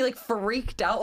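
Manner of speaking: ordinary delivery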